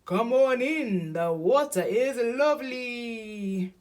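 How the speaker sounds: high, loud, drawn-out hippo invitation; inviting Hippo. A narrator's reading. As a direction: coax a friend in loudly.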